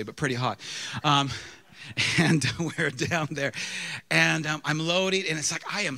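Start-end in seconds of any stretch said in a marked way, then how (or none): none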